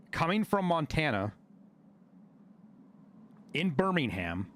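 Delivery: normal talking voice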